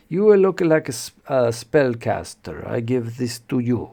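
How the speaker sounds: hammed-up Mediterranean-accented priest; Inigo Montoya. Priest hands the wizard a talisman, exaggerated Mediterranean accent. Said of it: "exaggerated, like, uh, Inigo Montoya".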